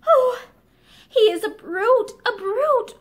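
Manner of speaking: indignant outcry. A narrator's reading. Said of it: "Barbara cried out at the pain".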